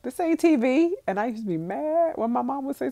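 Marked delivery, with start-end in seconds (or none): 0.0-1.0 stern mom. Retold or imitated. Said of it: "I have said stuff like".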